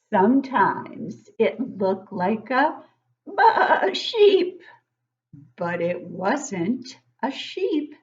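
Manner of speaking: slow, drawn-out storybook reading; reading to children. Acted. Teacher reading a picture book to young kids, slow and storybook-like. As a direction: read it slowly to the class, savouring each line.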